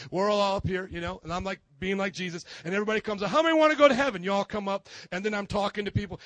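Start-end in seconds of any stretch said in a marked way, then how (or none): 3.3-4.2 calling out to crowd. Acted. Pastor playing Jesus calling crowd.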